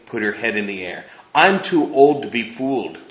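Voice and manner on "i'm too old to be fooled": put-on louder, stubborn old woman's voice held firm; persisting. Missus Sieppe insisting to Trina, stubbornly persistent. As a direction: refuse to be taken in. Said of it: "she persisted".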